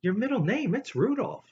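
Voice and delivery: high, playful imitation of dad; teasing the boy about his middle name; dad's voice. Dad teasing his son, high mock voice. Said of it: "Dad says to me".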